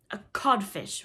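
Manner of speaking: loud, assured insult; mocking reply. A narrator's reading. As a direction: deliver the insult in answer to Hook. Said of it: "replied the voice".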